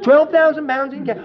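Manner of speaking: "Twelve thousand pounds in cash?" loud and high in disbelief; can't believe the offer; astonished disbelief. Retold or imitated. Neighbour stunned by cash offer.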